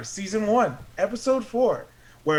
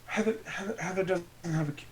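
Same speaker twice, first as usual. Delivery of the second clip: ordinary talk, nothing marked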